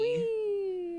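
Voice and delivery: high, playful whee; gleeful squeal. Real, not acted. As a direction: squeal with delight. Gleeful squeal at game event.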